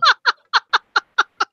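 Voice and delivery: squealing laugh; high burst of laughter. Real, not acted. Laughing mid story.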